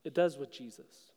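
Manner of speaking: soft, low and hushed; quiet answer. Real, not acted. Preacher answering his own question, hushed. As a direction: drop the voice to a hush for the answer.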